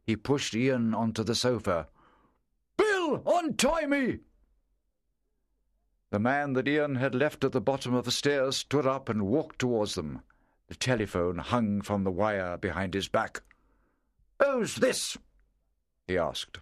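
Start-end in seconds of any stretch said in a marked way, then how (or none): 2.8-4.2 high, slow call for help; tied-up man calling out. Acted. Bound man calling his friend Bill, strained call. 14.4-15.2 suspicious man. Acted. Man finds boy in house.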